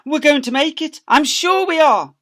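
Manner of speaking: high, loud, breathless hope; hopeful Mister Fox. Acted. Mister Fox cheering his family on, loud and high.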